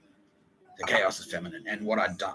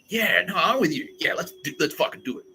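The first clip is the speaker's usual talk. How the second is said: higher, keen yes-man; eager agreement; 8 semitones higher than usual. Retold or imitated. Han Solo swooping in, eagerly joining the fight. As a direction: jump in, suddenly all in. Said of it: "Han Solo, the cool guy".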